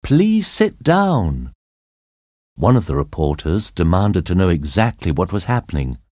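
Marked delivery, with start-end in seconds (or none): 0.0-1.5 higher, very slow and emphatic; insistent repeat. A narrator's reading. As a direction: drag out each word so they finally obey. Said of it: "Cathy said above the screams of the passengers".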